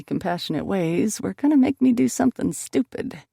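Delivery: ordinary delivery, nothing marked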